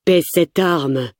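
slow, growling command; growled order. A narrator's reading. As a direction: growl it slowly as a warning. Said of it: "grogne Amir". (ordre grogné, lentement)

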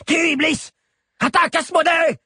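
high, loud screamed orders; insistent shout. A narrator's reading. Chado presses his disobedient caracals, high insistent screaming. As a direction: yell each beast into action. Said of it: "insista Chado".

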